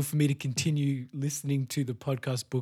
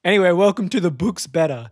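louder, higher announcer read; podcast intro; 3 semitones higher, 5 dB louder than usual. Acted. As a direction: open the show like a host. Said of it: "show intro".

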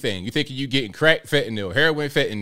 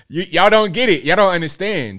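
louder and higher, fed up; y'all don't get it, said louder in disbelief; exasperated outburst; 4 semitones higher, 4 dB louder than usual. Real, not acted. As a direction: throw up your hands at them. Exasperated rant at the audience.

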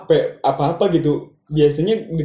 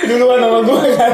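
high laughing protest; laugh-crying the words; 6 semitones higher, 3 dB louder than usual. Real, not acted. Laughing banter over the joke.

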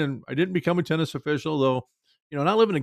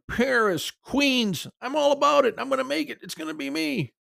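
high-pitched triumphant self-talk; excited inner voice; 7 semitones higher, slower than usual. Retold or imitated. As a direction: build higher and higher, giddy at the big break. Imagines his big break.

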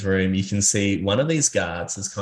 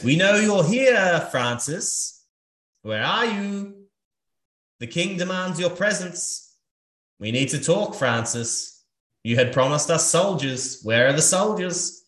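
knight calling out; 8 semitones higher, slower than usual. Acted. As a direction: call out like a knight searching a house.

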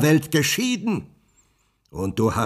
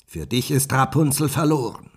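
scornful witch; 7 semitones higher, 8 dB louder than usual. Acted. The witch taunts the prince that Rapunzel is lost, loud and mocking. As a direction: relish crushing his hopes. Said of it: "rief sie höhnisch".

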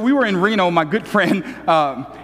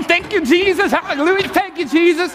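higher, jubilant retold praise; mock praise; 9 semitones higher than usual. Pastor reenacts his jackpot joy for the crowd, mock jubilant praise. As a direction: act out celebrating a tiny slot win. Retells dancing after winning.

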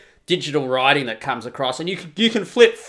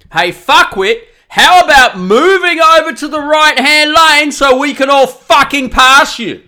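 loud, higher sneering yell; road rage taunt; 8 semitones higher, 7 dB louder than usual. Pretend. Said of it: "for the guy in the front".

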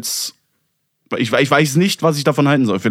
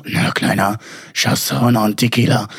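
low-voiced come-on to a little guy; imitated sultry voice; 4 semitones lower than usual. Co-host mimics her challenging him to tequila, imitated low voice. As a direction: imitate her original voice, pitched down. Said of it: "diese Stimme hast".

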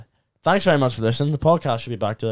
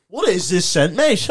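mock bloke voice; 6 semitones higher, 9 dB louder, slower than usual. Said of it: "I can't remember".

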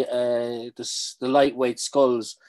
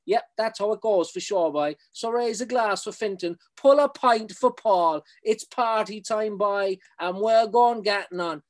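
Cork lingo, higher, slowing toward the end; raised Cork brogue, easing into the chorus; Cork accent; 7 semitones higher than usual. Acted. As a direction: toast the rowers like a West Cork local. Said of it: "very strong Cork accent".

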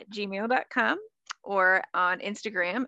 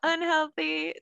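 excited; 8 semitones higher, 4 dB louder, slower than usual. Real, not acted. Self-correcting, joking about her health.

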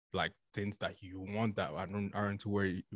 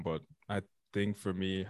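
ordinary delivery

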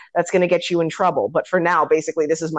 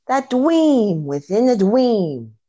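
slow, drawn-out, a bit higher mock sermon; mock clergyman; 5 semitones higher, much slower than usual. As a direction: stretch each word like a pompous preacher. Quoting the clergyman's speech.

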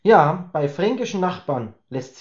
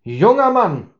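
louder, higher booming address; stern father-in-law; 7 semitones higher, 6 dB louder than usual. Acted. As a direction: boom it like a feared father-in-law. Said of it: "polterte er mit ehrfurchtgebietender Stimme". (strenger Schwiegervater, laut polternd)

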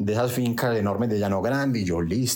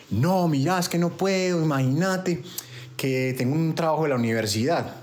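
higher-pitched mock female voice; imitated woman's excuse; 5 semitones higher than usual. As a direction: play her making excuses, pitched up. Man voices woman turning him down. (imitando a la chica, voz aguda)

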